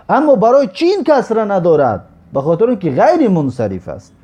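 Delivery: usual speaking voice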